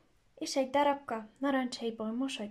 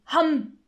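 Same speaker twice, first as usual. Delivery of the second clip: loud, slow snapping ham; chomp; 5 semitones higher, 11 dB louder than usual. Acted. Fox swallowing the gingerbread boy, loud slow chomp. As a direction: bite down on the word.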